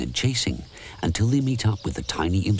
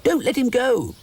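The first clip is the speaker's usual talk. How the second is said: much higher, louder shout; shouting imp; 4 dB louder than usual. A narrator's reading. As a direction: yell to stop the bear. Imps shouting orders.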